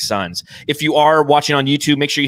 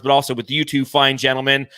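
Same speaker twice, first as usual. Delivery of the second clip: usual speaking voice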